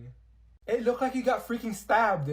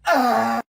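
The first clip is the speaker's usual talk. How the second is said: sudden loud cry of pain; pained scream; 3 semitones higher, 10 dB louder than usual. Acted. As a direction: yelp out loud as if stabbed. Olivia in pain on floor.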